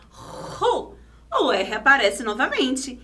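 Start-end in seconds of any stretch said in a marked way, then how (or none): 0.1-1.0 RU opening on a long breathy friction R; strong initial R. Shown as a demonstration. Teacher showing the second syllable, strong R. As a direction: rasp the R before U in "ru". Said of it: "E na segunda sílaba".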